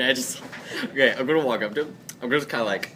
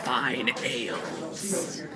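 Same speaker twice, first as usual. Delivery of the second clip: very slow, drawn-out, soft lure; enticing offer; 9 dB quieter, much slower than usual. Acted. Player tempting the hobgoblin with drink, slow hushed offer. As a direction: dangle each word like bait.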